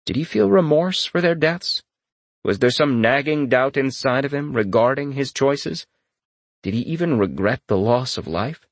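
ordinary delivery, nothing marked

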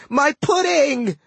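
high, drawn-out senile scream; old man screaming. A narrator's reading. Old god in battle for no apparent reason, high scream. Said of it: "kept screaming".

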